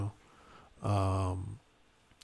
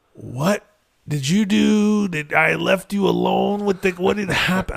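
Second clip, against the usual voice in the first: much higher, louder demanding questions; accusing wife; 8 semitones higher, 8 dB louder than usual. Retold or imitated. Wife grilling husband after child's injury, high and loud. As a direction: hammer him with suspicious alarm. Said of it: "my wife's like".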